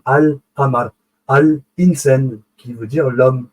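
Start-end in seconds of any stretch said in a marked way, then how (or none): 0.0-2.4 Al-Qamar, Al-Insan said slowly with a clear Al; teacher showing the pronounced article; slow demonstration. Shown as a demonstration. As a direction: spell out the Al, very slowly. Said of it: "qu'on a prononcé l'article Al".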